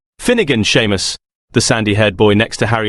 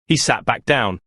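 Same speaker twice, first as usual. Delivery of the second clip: usual speaking voice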